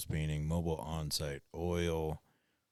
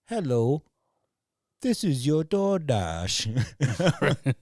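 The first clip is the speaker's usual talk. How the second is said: slow, higher and louder with a laugh; mock spam caller; 8 semitones higher, 7 dB louder, much slower than usual. DoorDash spam call imitated for friends, hammed-up and slow. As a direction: drag out the robocall greeting. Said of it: "it's like".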